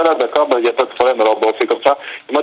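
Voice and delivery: ordinary talk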